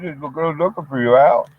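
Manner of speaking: quick, lightly put-on buddy voice; Kirby's voice. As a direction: toss it off fast like a grinning pal. Friend Kirby voiced at door.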